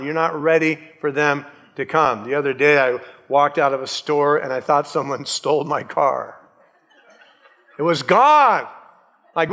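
ordinary talk, nothing marked

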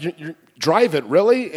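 loud, incredulous retold question; indignant and punchy; a little aggro. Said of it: "I got a little aggro".

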